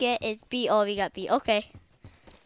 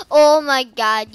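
startled gasp; 9 dB louder than usual. Real, not acted. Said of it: "you scared me so much".

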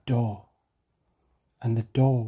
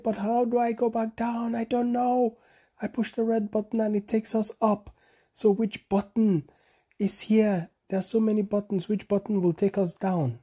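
high, flustered monkey voice; confused monkey; 6 semitones higher than usual. A narrator's reading. Bonobo lost in the rocket, high and flustered. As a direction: fret high about which button to press. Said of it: "Bonobo said".

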